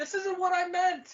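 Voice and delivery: high, loud panicked yell; shrieking as things fly at him; panicked shout. Acted. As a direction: cry out in alarm at the attacker. Luke pleading under attack.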